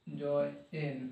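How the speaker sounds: "Join" split as joy, pause, en, softly; quiet, halting joy... en; broken into syllables. Shown as a demonstration. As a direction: sound out "join" in two separate parts. Teacher splitting word into parts.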